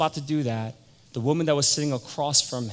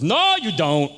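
loud, high, jumping-in refusal; emphatic objection; 5 semitones higher, 7 dB louder, slower than usual. Retold or imitated. Harry stops Tony's party plan, loudly objecting. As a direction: cut them off with a loud no.

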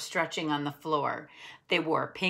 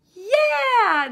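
high, loud joyful whoop; cheer; 11 dB louder than usual. A narrator's reading. Nate cheering at Mom's good news, loud and high. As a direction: burst out with delight. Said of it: "Nate cheered".

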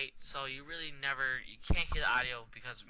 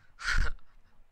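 short laugh; 6 dB louder than usual. Real, not acted. Crash ends the episode.

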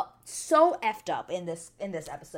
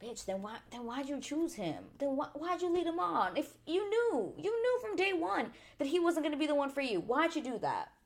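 rising, exasperated calling out; exasperated rant; 8 semitones higher than usual. Pretend. Vlogger scolding Francesca for leading Dom on, exasperated. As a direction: scold her for leading him on. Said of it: "And I was like".

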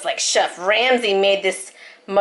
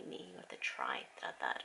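whisper; 24 dB quieter than usual. Real, not acted. Mother whispering to child.

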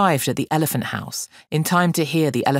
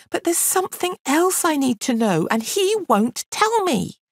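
put-on frustrated girl; 9 semitones higher, 4 dB louder than usual. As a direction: list it all, rising in exasperation to the end. Girl's frustration builds.

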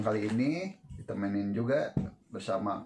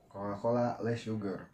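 glass at lips; speaking between sips; drinking while talking. Real, not acted. Seen 3: Glass held at lips, sipping cola throughout the labelled words.

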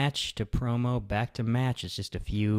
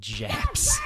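mock yell. Cohost echoes the wrestler's rant with a mock shout. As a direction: bark it out like the ranting wrestler. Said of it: "yelling about the Road".

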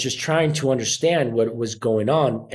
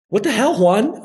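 stepdad's incredulous outburst, higher; mock stepdad; 7 semitones higher than usual. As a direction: play the baffled stepdad. Stepdad reacting to acting plan.

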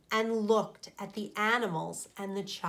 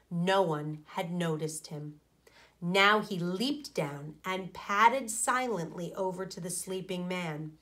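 plain reading voice

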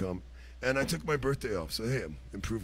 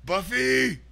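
high loud greeting shout; calling out; 12 semitones higher, 8 dB louder than usual. Real, not acted. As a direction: yell her name in greeting. Greeting a viewer in chat.

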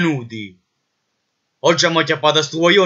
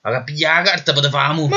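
usual speaking voice

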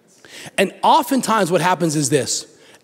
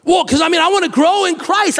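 eager believer; 8 semitones higher than usual. Retold or imitated. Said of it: "you're like".

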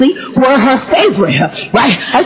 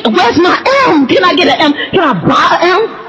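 high, pleading mock outrage; mock indignation; 5 semitones higher than usual. Grandchild to Grandma over her name, mock indignant plea. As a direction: demand the missing letter, higher each time.

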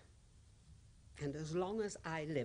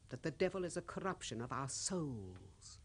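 usual speaking voice

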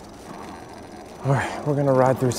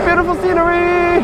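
high silly voice. Pretend. High put-on voice, +16 st.

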